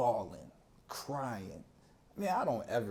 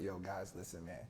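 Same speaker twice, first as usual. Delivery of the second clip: much softer, lower admission; sheepish confession; 6 semitones lower, 12 dB quieter than usual. Retold or imitated. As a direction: own up quietly to your boys. Confessing to friends.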